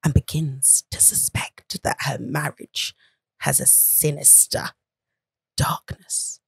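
slow, hushed, creepy whisper; low whispered horror read, drawn out slowly. Pretend. Reading a film synopsis in their best horror voice, creepy whisper. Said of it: "in your best horror voice".

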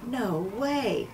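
soft, under the breath disbelief; whisper. Acted. Jack whispering in disbelief on seeing Annie in the boat. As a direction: barely say it aloud. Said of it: "he whispered".

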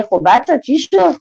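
ordinary delivery

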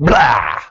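loud, drawn-out "blah" burst; explosive outburst. Acted. Speaker mimicking bottled emotion coming out, explosive blurt. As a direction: let it erupt like bottled-up emotion. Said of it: "it comes out in a".

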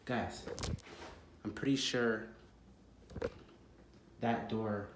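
low, soft, halting wariness; hushed suspense. Acted. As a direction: keep quiet, pausing to listen. Listening for intruder in house.

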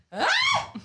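sudden high shriek, half laughing; a mock panicked squeal at the dropped biscuit; retold shriek. Telling the co-host how she shrieked at the fallen gingerbread man, a high laughing squeal. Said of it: "I went".

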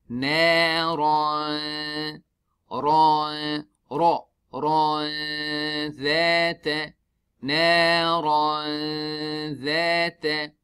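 Naran with thick ra, then dhata with a smile on the dhal; thick ra, smiling dhal. Shown as a demonstration. Teacher demonstrating ikhfa from thick ra to thin dhal. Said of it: "show the thickness of ra and then we smile for the dhal".

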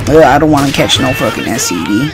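ordinary talk

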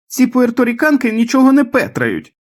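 very high, loud indignant cry; mother's piercing outburst; shouting. A narrator's reading. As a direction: yell it out high, as a delirious complaint. Delirious mother yelling from the bedroom.

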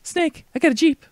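very high put-on sidekick voice; high-pitched character. Sidekick telling Snake about a Jeep, high voice.

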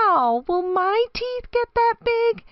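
very high, wide-eyed little hippo voice; excited asking. A narrator's reading. Baby hippo marvelling at her mommy's teeth, excited. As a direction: ask in high, amazed excitement. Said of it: "she asked excitedly".

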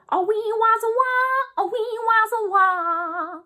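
high wailing guitar imitation; electric guitar noise. Shown as a demonstration. Teacher to kids, imitating an electric guitar up high. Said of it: "that sounds something like this".

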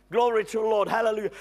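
usual speaking voice

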